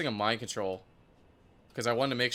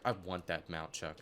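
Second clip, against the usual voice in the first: much softer, low mock quote; hushed mock demand; 9 semitones lower, 12 dB quieter than usual. Retelling Cass's text to viewers, hushed mock quote. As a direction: drop way down and imitate her demand.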